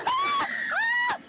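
very high loud shrieks; startled screams. Real, not acted. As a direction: scream in real fright. Jump scare in haunted maze.

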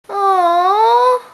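disappointed aww. Acted. Declared guilty, disappointed.

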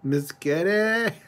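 high, drawn-out pet call; calling the cat. Real, not acted. Owner calling to her kitty, high and drawn out. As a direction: stretch it out high, coaxing a cat.

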